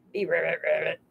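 low, soft playful ribbit; low playful frog croak. Pretend. Playing frog again.

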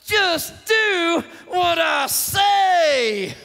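very slow, strained shout; frustrated holler. Acted. Dad at wits' end with his kids, hollering. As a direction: bellow it out one word at a time. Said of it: "to say it like that".

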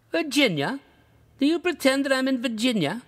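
Virginia? shot up high, disbelieving; can't believe what he hears; incredulous. A narrator's reading. Rugg to the narrator, high incredulous question.

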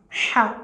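"Cha" said with a smooth, soft breathed h, no throat scrape; smooth soft letter sound. Shown as a demonstration. Teacher drilling students on the smooth soft "Cha". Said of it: "Mais c'est très lisse, très douce".

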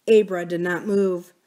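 ordinary delivery, nothing marked

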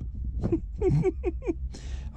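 high, voiceless staccato chuckle; old man's high wheezing giggle; wheezy laugh. Acted. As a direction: laugh in soft, high bursts. Grandad laughs after his catchphrase.

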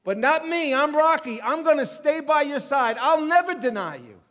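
hammed-up bragging promise; boastful Peter. Preacher voicing Peter bragging to Jesus before the disciples, loud and cocky. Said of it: "Peter said in front of all the guys".

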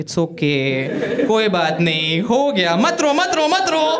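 put-on high, cooing comfort like to a crying baby; mock consoling. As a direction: soothe it, rising into baby talk. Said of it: "लंड को वापस समझा रहे".

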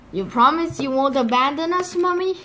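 put-on high child voice, rising to a question; anxious little chick. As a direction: plead for reassurance like a little one. Anxious chick asks mommy.

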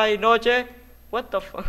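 high, loud yelp of surprise; startled cry. Real, not acted. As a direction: cry out as darkness suddenly falls. El juego oscurece de golpe. (grito de sorpresa)

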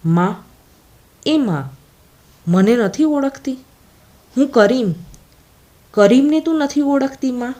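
a son begs his mother to recognise him, understated choked voice. A narrator's reading. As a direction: plead with your mother, lightly choked. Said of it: "અત્યંત ધીમે, ગળગળે અવાજે બોલી રહ્યો હતો".

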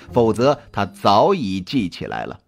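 usual speaking voice

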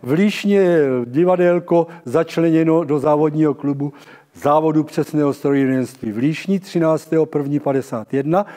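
normal talking voice

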